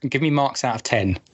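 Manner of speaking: normal talking voice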